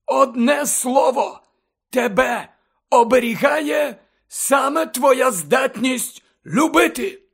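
firmly projected, emphatic declaration. Acted. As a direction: declare it slowly, then drive it home. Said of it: "голосно сказав Дамблдор".

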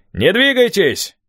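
high, slow, carrying order; commanding shout. A narrator's reading. Unseen man in the trees orders the boys to halt, shouting. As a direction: call out a halt from a distance.

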